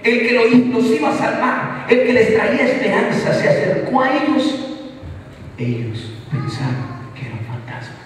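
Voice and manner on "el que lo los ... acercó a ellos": high, loud fervent preaching; full-force preaching. Acted. Pastor to his congregation, in fervent raised preaching. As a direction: proclaim it to the whole church.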